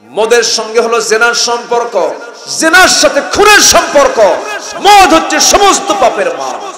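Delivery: loud, high preaching at full force; fiery sermon. Acted. Preacher thundering at the crowd about wine.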